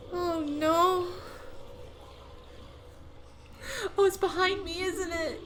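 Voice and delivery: high scared laughing whimper; being chased. Real, not acted. Monster behind her.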